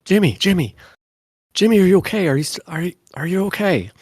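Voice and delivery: high, frantic calling of a name; panicked. Acted. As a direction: shake him, scared he's hurt. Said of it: "and was like".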